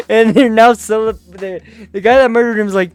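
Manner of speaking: ordinary talk, nothing marked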